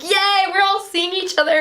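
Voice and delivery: high, bubbly cheer; giddy cheer. Retold or imitated. Islanders cheer as they all meet up, high giddy cheer. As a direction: squeal it like an overjoyed partygoer.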